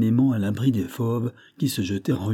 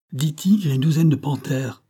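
raised, higher-pitched cry; exclaimed alarm; 6 semitones higher, 4 dB louder than usual. A narrator's reading. Supplier atop the ladder reporting wild beasts, alarmed cry. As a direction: call out the sighting urgently. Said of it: "s'écria-t-il".

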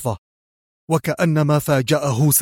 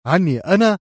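higher, raised startled cry; short startled exclamation, pitched high; screamed question; 6 semitones higher, 4 dB louder than usual. A narrator's reading. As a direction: cry out in disbelief. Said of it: "صرخ كواليسكي".

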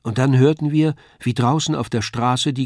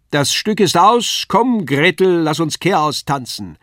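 Kasperl calling out; 7 semitones higher, 7 dB louder than usual. A narrator's reading. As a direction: announce the show's end to the whole hall. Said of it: "hörte ich Kasperl den Zweiten rufen".

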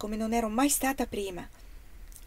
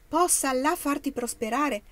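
esclamazione meravigliata; 6 semitones higher, 4 dB louder than usual. A narrator's reading. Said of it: "esclamò Damni meravigliata".

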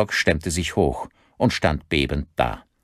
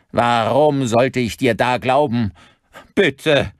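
high, raised, pleading voice; loud anguished plea; desperate pleading; 7 semitones higher, 5 dB louder, slower than usual. A narrator's reading. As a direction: beg in rising panic. Victim begs before being shot.

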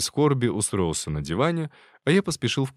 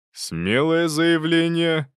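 slow, higher mocking remark; dry sarcasm; 8 semitones higher, 5 dB louder, much slower than usual. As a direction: drawl it out sarcastically.

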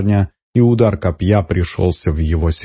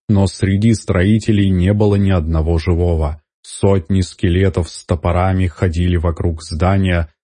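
ordinary delivery, nothing marked

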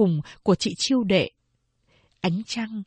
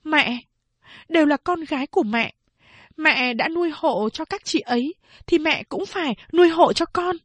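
raised, insistent reasoning; arguing daughter; 7 semitones higher than usual. A narrator's reading. Phán Đệ arguing with mother, raised pleading voice. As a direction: demand her share of care.

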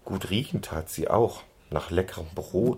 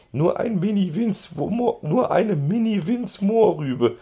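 much higher, teasing mimicry; grinning reminder; 12 semitones higher, 3 dB louder than usual. A narrator's reading. Schoko reminding his friend of his words, grinning and high-pitched. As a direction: remind him cheekily, way up high. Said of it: "erinnert Schoko ihn grinsend".

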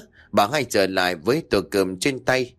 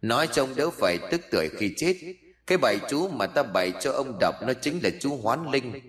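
soft, otherworldly taunting voice; mocking plant spirit; 5 dB quieter than usual. Spirit reveals its betrayal to dying sorcerer, softly taunting.

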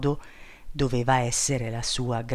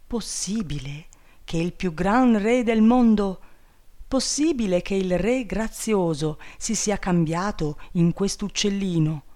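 higher, amazed disbelief; astonished princess; 6 semitones higher than usual. Acted. Princess realizes the bird is the king, amazed. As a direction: marvel that he became a bird.

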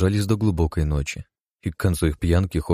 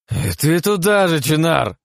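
put-on high, loud, furious drunken yell; drunken outburst; 11 semitones higher, 4 dB louder, slower than usual. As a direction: explode in a drunk rage. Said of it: "взбеленился в пьяном угаре парень".

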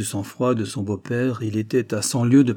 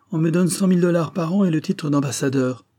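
majestic; 7 semitones higher, 4 dB louder than usual. A narrator's reading. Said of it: "déclara majestueusement".

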